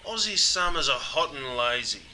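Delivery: ordinary talk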